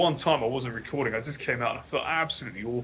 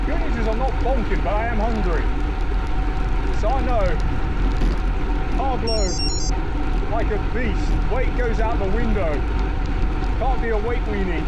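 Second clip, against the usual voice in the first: talking loudly while riding; 3 semitones higher, 9 dB louder than usual. Real, not acted.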